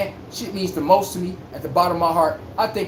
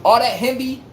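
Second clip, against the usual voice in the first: ordinary talk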